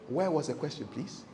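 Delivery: soft, polite smiling put-down; smiling diplomatically. Acted. Emcee to a rambling audience member, smiling diplomatically. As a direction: ask it quietly, lowering to a gentle "please". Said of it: "I'll be smiling very diplomatically".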